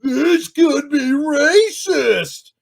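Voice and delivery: hammed-up mock of a fussy critic; mocking whiny imitation. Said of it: "he just goes on and on about".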